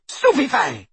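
high-pitched screamed incantation. A narrator's reading. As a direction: scream it as an attack. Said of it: "she screamed".

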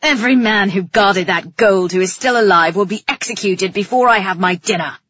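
loud, growled threat; angry growl. A narrator's reading. King threatening to execute the guards, growling. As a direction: growl it out as a royal decree. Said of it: "he growled".

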